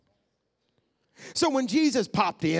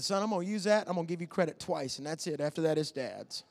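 sly quiet aside; 2 semitones lower, 7 dB quieter than usual. Retold or imitated. Dad claiming his son's line, sly and quiet. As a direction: drop the voice as if making a private deal. Retold sly deal with son.

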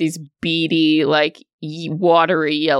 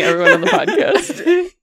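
laughing; 12 semitones higher, faster than usual. Real, not acted. Group laughing.

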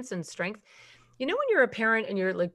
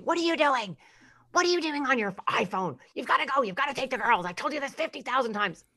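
nagging wife; 5 semitones higher than usual. Retold or imitated. Wife nagging her husband about his phone, scolding voice. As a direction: snap at him like an exasperated wife. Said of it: "like if your wife came in and said".

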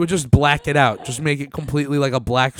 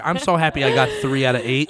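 usual speaking voice

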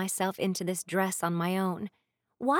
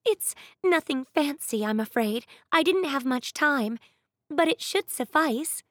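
put-on higher, hurried young maid; rushed servant girl apology; quick apologetic maid; 6 semitones higher, 4 dB louder than usual. A narrator's reading. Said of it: "quick to add".

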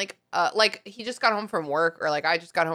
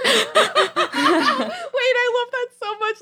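laughing; 4 dB louder, slower than usual. Real, not acted.

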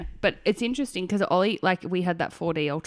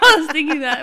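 high, loud laughing words; real giggly agreement, pitched up; talking through laughter; 9 semitones higher, 9 dB louder than usual. Real, not acted. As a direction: keep talking while cracking up. Still laughing at the same joke.

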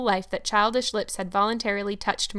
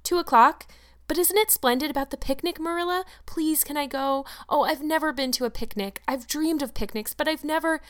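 put-on excited girl's voice, higher throughout; bubbling child begging to go to the picnic; eager young Anne; 6 semitones higher than usual. Anne pleads again.

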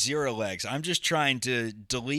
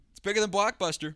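higher, fast, put-on voice, mock boast; mock grand claim; 6 semitones higher, faster than usual. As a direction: announce it like a ridiculous fact. Joking deadpan announcement.

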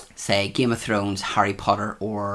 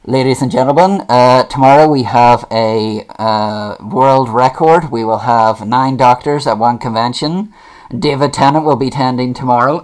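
convention announcer; 2 semitones higher, 10 dB louder than usual. Retold or imitated. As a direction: boom it out to the whole hall. Retelling a convention's public announcement.

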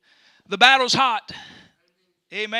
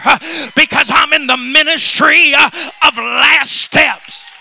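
loud preaching, rising to a roar; building sermon shout; 7 dB louder than usual. Acted. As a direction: preach it louder and louder. Preaching climax.

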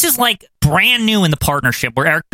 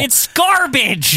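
slow, high, dragged out; disgusted; 8 semitones higher, much slower than usual. Real, not acted. Fan trashing Hogan's promo, slow high disgust. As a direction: stretch it in disgust. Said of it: "disgust at Hogan".

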